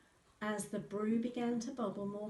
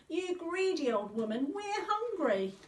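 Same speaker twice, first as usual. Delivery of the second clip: high, loud scolding shout; 10 semitones higher, 7 dB louder than usual. A narrator's reading. Girl scolds the greedy witch, shouting. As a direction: yell it right in her face. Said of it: "Kate shouted at her some more".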